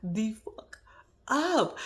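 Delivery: slow, drawn-out mock scolding; telling Donna off. Vlogger retelling what she told Donna, slow drawn-out scold. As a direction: stretch it out like scolding a pesky mom. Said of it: "I said".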